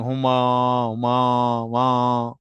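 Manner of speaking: low, drawn-out lowing call; low for Krishna like a trapped cow. Acted.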